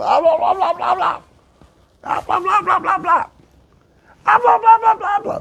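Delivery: very high rapid gobbling; repeated high blah-blah turkey calls; turkey gobble imitation. Turkey making noise atop the tree, high gobbling.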